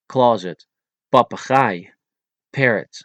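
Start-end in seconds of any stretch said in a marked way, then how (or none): 1.1-1.9 Papegaai with no puff on either P; unblown P. Shown as a demonstration. Teacher showing Dutch letters, Papegaai with unblown Ps. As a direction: say Papegaai in Dutch, both Ps cut short. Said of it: "without blowing those three letters".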